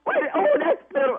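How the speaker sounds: usual speaking voice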